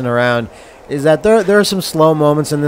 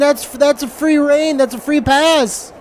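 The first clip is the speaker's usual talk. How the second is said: whiny excuse; 11 semitones higher than usual. Acted. Voicing drunk husband.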